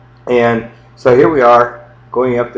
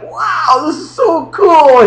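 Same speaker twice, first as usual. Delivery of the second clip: high amazed wow; 4 dB louder, much slower than usual. Retold or imitated. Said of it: "It's like".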